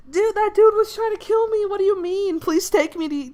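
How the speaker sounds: high, loud start, easing off gradually; acted frantic pleading. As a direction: plead in mock panic with a friend. Max pleads with friend after escape.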